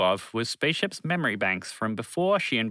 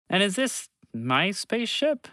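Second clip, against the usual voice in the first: higher, slower, amazed question; can't believe his eyes; incredulous; 8 semitones higher than usual. Acted. Bobby sees his old spaceship, slow amazed question. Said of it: "he asked incredulously".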